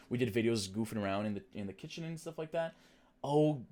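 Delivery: normal talking voice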